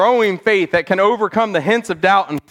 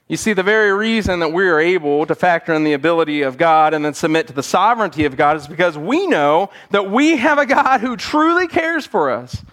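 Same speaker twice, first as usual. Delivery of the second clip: normal talking voice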